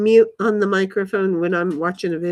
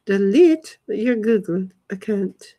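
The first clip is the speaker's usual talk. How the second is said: ordinary talk, nothing marked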